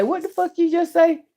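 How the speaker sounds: high, sharp what-did-you-say; blunt mock retort. Shown as a demonstration. Showing how she answers her cousin's husband, blunt and incredulous. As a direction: snap it back in disbelief. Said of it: "And say it just like that".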